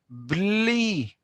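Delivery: בלי drawn out slowly and clearly, b-li; slow model word. Shown as a demonstration. Teacher modelling בלי slowly for the students. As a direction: stretch בלי so the class can repeat it. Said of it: "you will use the word בלי".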